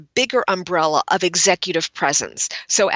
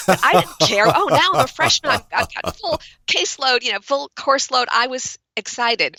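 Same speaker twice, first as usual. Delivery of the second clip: excited memory; 5 semitones higher than usual. Retold or imitated. Excited freshman recalling enrollment.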